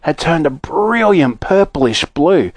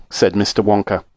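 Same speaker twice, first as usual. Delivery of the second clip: ordinary delivery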